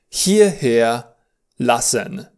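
hierher lassen drawn out slowly; slow teaching model. Shown as a demonstration. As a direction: stretch hierher lassen for the class. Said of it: "Ich möchte".

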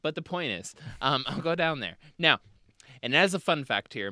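normal talking voice

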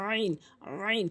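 আইন squeezed from mid-throat; pressed throat ain. Shown as a demonstration. Teacher drills the letter, pressed throaty আইন. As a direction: begin আইন with a tight throat constriction. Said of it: "চাপ দিয়ে হলকের মাঝখান থেকে".

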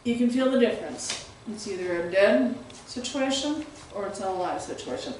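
normal talking voice

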